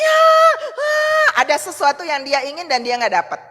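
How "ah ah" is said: put-on high crying shout of a child in a tantrum; child's tantrum wail. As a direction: wail like a furious little kid. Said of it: "lagi ngamuk". (tangisan anak yang mengamuk)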